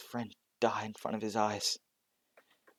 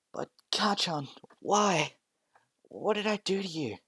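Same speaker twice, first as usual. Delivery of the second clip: high, hushed, hurt pleading; hurt plea; 9 semitones higher than usual. Acted. Bullied Deku begging Kacchan, high and hurt. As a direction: beg him why, close to tears.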